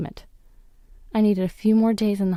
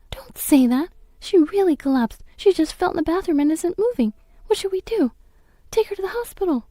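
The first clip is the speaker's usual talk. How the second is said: high, loud, rising panic; a child begging urgently, higher each line; desperate pleading; 10 semitones higher, 3 dB louder than usual. Acted. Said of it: "I could hear them arguing".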